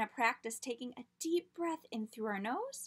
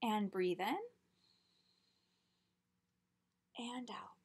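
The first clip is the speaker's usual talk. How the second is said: guided breathing; 4 dB quieter than usual. Shown as a demonstration. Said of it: "Let's try it".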